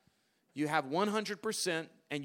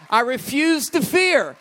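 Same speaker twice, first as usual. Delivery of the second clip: much louder and higher, defiant shout; shouted declaration; 7 semitones higher, 12 dB louder than usual. Acted. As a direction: belt it out like a vow.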